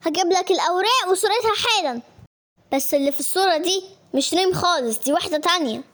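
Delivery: ordinary talk, nothing marked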